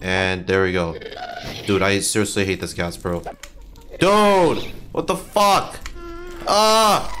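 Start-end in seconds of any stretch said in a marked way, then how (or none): 4.0-7.2 outraged yell. Real, not acted. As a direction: shout in disbelief at the game. Ghast attack surprise.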